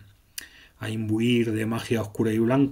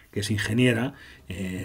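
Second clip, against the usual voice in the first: usual speaking voice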